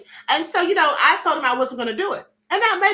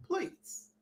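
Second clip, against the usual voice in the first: quiet aside; 3 semitones higher, 15 dB quieter than usual. Real, not acted. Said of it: "quiet aside".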